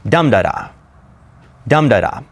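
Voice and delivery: dum-da-da, strong beat then two light; dactyl stress. Shown as a demonstration. Teacher showing how dactyl sounds, DUM-da-da. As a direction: hit "dum", trip lightly off "da-da". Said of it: "It sounds like".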